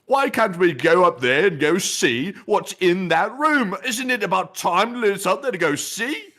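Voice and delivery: angry posh couple. Retold or imitated. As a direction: play the indignant posh Brits chewing out the attendant. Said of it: "They were like".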